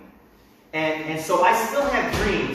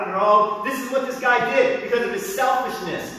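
ordinary delivery

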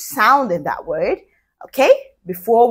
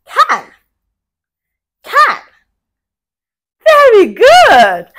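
excited high praise; 13 semitones higher, 8 dB louder, slower than usual. Real, not acted. Teacher echoing kids with praise.

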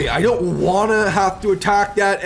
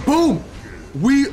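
excited boom; 9 semitones higher than usual. Real, not acted. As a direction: celebrate the kill. Kill lands.

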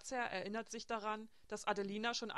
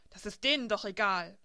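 bitter, louder snap at the others; hissing retort; 8 dB louder than usual. A narrator's reading. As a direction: spit the words out bitterly. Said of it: "fauchte die Schwangere". (giftig und lauter gefaucht)

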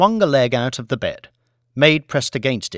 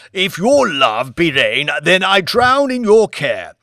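put-on theatrical old lord; 6 semitones higher, 6 dB louder than usual. A narrator's reading. Old lord answers his wife in mock-Shakespeare. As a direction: declaim it like a tragedian.

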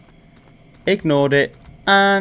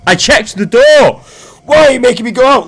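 indignant; 8 semitones higher, 7 dB louder than usual. Real, not acted. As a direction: protest that you did check it. Louder indignant repeat of line 1.

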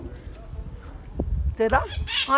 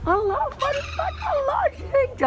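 excited spotting; slower than usual. Real, not acted.

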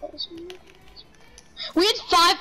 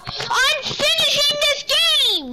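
drawn-out shout; 8 semitones higher, much slower than usual. Real, not acted. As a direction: stretch it out to answer someone far away. Answering a call from another room.

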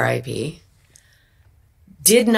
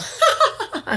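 sudden high burst of laughter; big laugh. Retold or imitated. Actress hitting her cue with a big laugh. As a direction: let a big scripted laugh rip. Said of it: "I do a big laugh".

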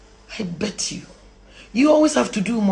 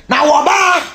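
higher, loud exclamation; raised, forceful cry; emphatic shout; 4 semitones higher, 6 dB louder than usual. Real, not acted. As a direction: belt it out, stretching wɔbaa. Calling out emphatically.

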